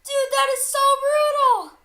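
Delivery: high, loud thrilled exclaim; amazed shout. Real, not acted. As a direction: gush over the carnage. Reacts to brutal kill.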